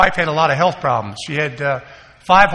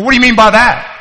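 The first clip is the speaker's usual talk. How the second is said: sharp, much louder and higher comeback; defensive retort; 7 semitones higher, 9 dB louder than usual. Retold or imitated. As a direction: snap back, stung by the remark. Defensive retort to mother's remark.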